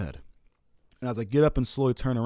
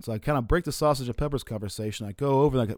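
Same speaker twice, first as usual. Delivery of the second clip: ordinary talk, nothing marked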